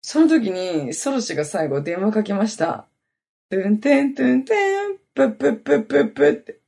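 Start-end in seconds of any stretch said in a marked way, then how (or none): 3.5-6.6 high quick beeping mouth noise; phone connecting sound. Retold or imitated. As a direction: imitate the call connecting, fast. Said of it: "これ繋がる時の音なんだけど". (電話がつながる音のまね)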